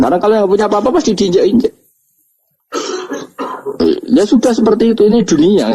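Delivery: high, fast, insistent; emphatic preaching. Real, not acted. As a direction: drive the point home. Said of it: "preaching emphatic point".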